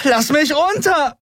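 high, drawn-out protest yell; screamed demand. A narrator's reading. She is carried off under his arm, screamed demand. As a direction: scream to be released. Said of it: "schrie sie".

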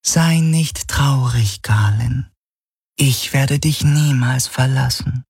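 seductive phantom lover. Acted. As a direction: coax him gently like a phantom of his love. Helix again as Kirai.

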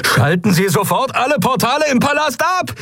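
very high shrieked order; shrieking. A narrator's reading. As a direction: shriek over sirens. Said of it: "kreischte Galet über die Sirenen hinweg".

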